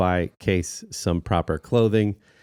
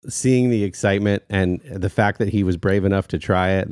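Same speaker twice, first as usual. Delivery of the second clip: normal talking voice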